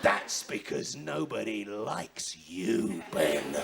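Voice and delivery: very low, slow sinister voice; cruel verdict. Acted. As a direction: drop deep and deliver it slowly. Captain delivers cruel verdict.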